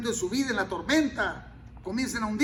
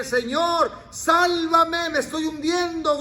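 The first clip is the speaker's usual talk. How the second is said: higher, louder desperate plea; Peter crying for help; 6 semitones higher, 6 dB louder, slower than usual. Retold or imitated. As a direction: cry out like a sinking man. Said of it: "para que grites". (súplica desesperada, más alta y fuerte)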